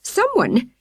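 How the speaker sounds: high, slow, breathless distress; woman reports the ransacked tents breathlessly; breathless alarm. A narrator's reading. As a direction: gasp it out, upset. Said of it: "she added breathlessly".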